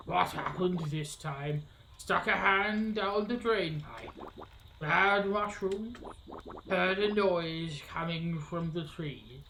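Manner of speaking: slow, drawn-out witch questioning; grumbling alligator witch. Acted. Witch asking a visitor what happened, slow drawl. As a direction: stretch each word as a weary swamp witch.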